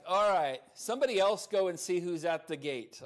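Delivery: loud, giving-in mock command; exasperated order.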